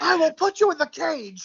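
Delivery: co-host joking about silencing a kid, mock menace; mock menacing parent. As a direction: threaten like a cartoon villain. Joking mock threat to child.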